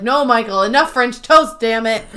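loud, firm outburst; mock exasperated scolding. Pam grabbing the bowl from Michael, exasperated. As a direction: put your foot down, retold exasperation.

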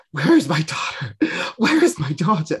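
lightly American, anxious Nicole Kidman; a hint of American, worried mother calling; mild American-accented mother searching the house. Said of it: "gave her an American accent there".

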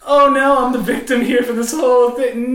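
high, loud whiny mock; mock her self-pity; hammed-up whining stripper; whiny victim mock. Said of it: "girls that are just strung out".

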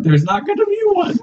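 high, indignant denial; exasperated. Real, not acted. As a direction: shut the idea down firmly. Denying the AU game.

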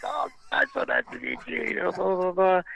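high-pitched starstruck babble; mock gushing, trailing into blahs; gushing fan greeting. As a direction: gush it high, like a starry-eyed fan.

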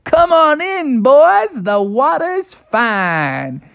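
very high, stretched-out cartoon call; high, carefree bear cub voice; inviting Bubby. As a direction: coax the gang in with a slow singsong finish. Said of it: "He's like".

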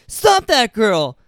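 acted commanding yell; Gene Kelly's shout. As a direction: call out slowly to a crowd. Acting Gene Kelly calling out.

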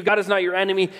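ordinary talk

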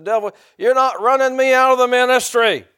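high, loud, defiant shout; yelling at the devil. Retold or imitated. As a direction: yell it as a retold outburst of defiance. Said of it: "and I started yelling".

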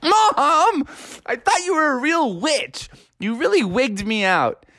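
put-on high-pitched child's complaint; indignant protest. Froggy scolds mom dressed as witch, indignant. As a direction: start high and whiny, then ease down.

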